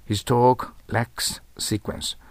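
"His talk lacks sequence" read very slowly, each word clear; slow model sentence. Shown as a demonstration. Teacher slowly reading the example sentence to the class. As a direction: drag out every word for the learners. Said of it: "彼の話は前後が欠けている".

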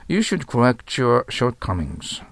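slow, careful example sentence with an English r in "correct"; slow model sentence. Shown as a demonstration. Teacher reading the example slowly so students catch the r. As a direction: read it slowly, leaning on the r of "correct". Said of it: "correct Rですよ".

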